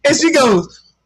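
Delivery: normal talking voice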